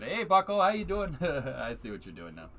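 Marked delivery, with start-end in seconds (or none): 0.0-1.2 a touch louder, higher folksy "Hey, Bucko"; hearty fake-friendly hello; mock Canadian greeting. Quoting a chat message, folksy Canadian greeting. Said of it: "You said".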